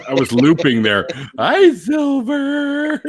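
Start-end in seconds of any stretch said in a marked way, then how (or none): 1.4-3.0 high, slow, loud drawn-out hello; sing-song shout. Real, not acted. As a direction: call the name out high. Greeting guest loudly sing-song.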